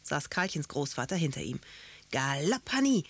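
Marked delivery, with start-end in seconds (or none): 2.1-3.0 rousing cry, leaping high and loud; gleeful rider's command, rising sharply; calling out. A narrator's reading. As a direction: spur a mount on with a shout. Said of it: "wollte Karlchen gerade rufen".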